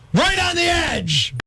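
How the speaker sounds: high, drawn-out comic shout; snapping outburst. Acted. Snapping in front of co-host, exasperated shout. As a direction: burst out high, stretching the last word. Rant climax, low then screamed.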